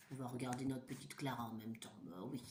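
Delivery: dropped low and soft, quick; hushed aside. Real, not acted. Aside about the sleeping child.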